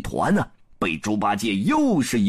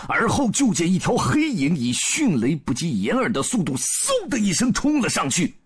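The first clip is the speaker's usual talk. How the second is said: ordinary delivery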